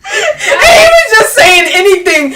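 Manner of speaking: high, loud, laughing-crying outburst; laughing rant. Real, not acted. Telling a friend about Frank, high and laughing. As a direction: squeal it out through laughter.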